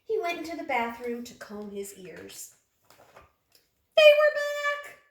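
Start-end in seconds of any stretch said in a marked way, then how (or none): 4.0-5.0 much higher, loud shriek of alarm; shocked cry. A narrator's reading. Shock reveal in story.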